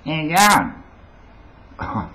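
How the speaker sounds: high, loud, drawn-out Aussie greeting; hammed-up street Australian, slurred together; broad Australian accent. Shown as a demonstration. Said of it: "couldn't get on the radio and say".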